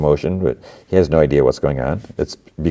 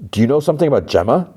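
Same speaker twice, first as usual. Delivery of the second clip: fast, higher urgent ask; urgent mock question; 8 semitones higher, faster than usual. [name] pressing her about Gemma, urgent. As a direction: rush the question out.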